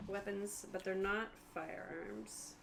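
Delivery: ordinary talk, nothing marked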